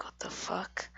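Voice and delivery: hushed, whispered reaction; retold thought under the breath; whispered disbelief. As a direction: whisper it as a baffled aside. Muttered aside reaction in retold scene.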